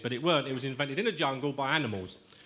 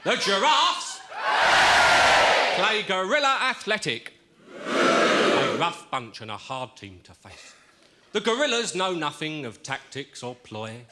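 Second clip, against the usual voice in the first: sports commentator; 3 semitones higher, slower than usual. Acted. Football commentary verse delivery.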